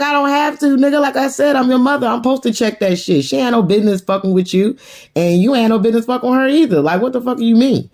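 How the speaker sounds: high, insistent scolding; indignant mother. Retold or imitated. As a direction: act out telling off a son. Mother scolding son in retold quarrel.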